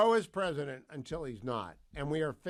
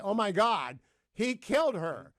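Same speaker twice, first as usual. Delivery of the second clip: higher, louder, acted eyewitness outcry; excited exclamation; 6 semitones higher, 6 dB louder than usual. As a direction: exclaim it like a shocked bystander. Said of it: "a term in evidence called the excited exclamation".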